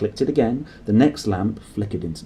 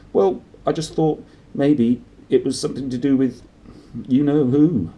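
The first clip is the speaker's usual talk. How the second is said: higher, cagey nervous husband; 6 semitones higher, 6 dB louder than usual. A narrator's reading. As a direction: tiptoe round it nervously. Vernon nervously voiced.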